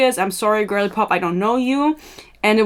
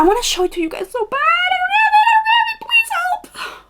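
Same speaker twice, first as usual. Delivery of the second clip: builds from a quick shout to a very high mock wail; hammed-up despair, squeaking high and ending in a fake cry. Can't find the screenshot, mock despair.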